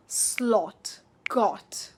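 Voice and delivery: SLOT and GOT with rounded British O; short rounded O. Shown as a demonstration. Teacher modelling for repetition, short rounded O. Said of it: "It's pronounced after me".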